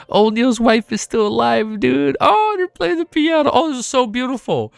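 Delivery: high, overcome gushing; tearful, moved. Real, not acted. Reader learns O'Neill's wife is alive, tearfully moved. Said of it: "making me cry even more".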